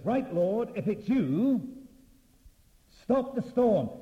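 loud, high preacher's plea to the Lord; bold challenge. Acted. A disciple challenges the Lord in the storm, loud and bold. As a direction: call it out high and forcefully.

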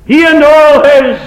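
high, loud, slow cry; loud sermon peak; preaching at full force. Acted. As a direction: cry it out from the pulpit.

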